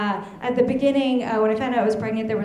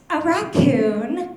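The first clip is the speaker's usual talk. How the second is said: put-on higher, drawn-out little kid answer; three-year-old child; 5 semitones higher, much slower than usual. Three-year-old answering what she wants to be, childlike.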